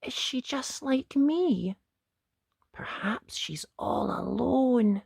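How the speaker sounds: Ned pitying the lonely dragon, hushed and sad; wistful wondering. Acted. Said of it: "Ned wondered".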